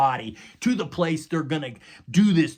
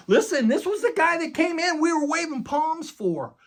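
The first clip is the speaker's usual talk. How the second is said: much higher, disbelieving crowd voice; incredulous crowd; 3 dB louder than usual. Retold or imitated. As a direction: voice the baffled crowd who waved palms. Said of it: "the perception of the people is like".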